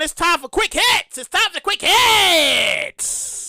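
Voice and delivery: slow, stretched segment opener; announcer intro. Acted. Host to viewers, introducing the quick hits segment, slow announcer voice. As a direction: announce it like a show bumper. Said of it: "segment announcement".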